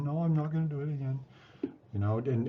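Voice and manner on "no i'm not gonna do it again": a hint of a small child answering, higher; child reciting the reason, a touch higher; lightly voiced child. A child answering the parent who spanked him, lightly voiced.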